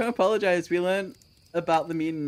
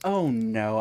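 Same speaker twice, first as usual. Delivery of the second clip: normal talking voice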